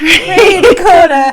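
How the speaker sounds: high, slow jingle through laughter; laughing announcer. Acted. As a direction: stretch it out while laughing.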